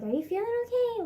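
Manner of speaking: high-pitched, quick imitation of a concerned dad. Dad checking on the child at dinner, mock concern. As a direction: lightly mimic dad checking on you. Retelling dad's concerned question.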